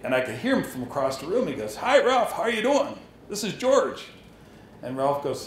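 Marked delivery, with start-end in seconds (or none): 1.8-4.1 imitating a big, hearty caller down the line; booming voice. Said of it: "Bloom had this real booming voice".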